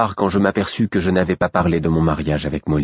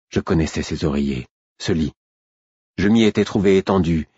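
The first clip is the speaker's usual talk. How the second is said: ordinary delivery, nothing marked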